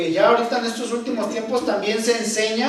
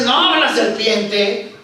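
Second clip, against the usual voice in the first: Eve blaming; 8 dB louder, much slower than usual. Retold or imitated. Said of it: "la mujer dice".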